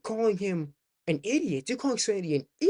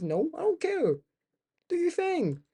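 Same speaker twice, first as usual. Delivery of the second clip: mock high voice; 10 semitones higher than usual. Mock dismissive, imitating own sensitivity.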